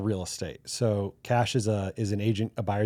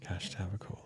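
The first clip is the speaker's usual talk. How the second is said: softer, "Tavicoli" said through a real laugh; much softer amused second try at "Tavicoli"; quiet laughing retry; 2 semitones lower, 15 dB quieter than usual. Real, not acted. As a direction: giggle your way through the name again.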